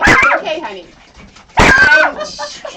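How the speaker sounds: cooing to a pet. Real, not acted. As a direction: coo to a scared cat in a squeaky voice. Soothing the startled cat Alfred.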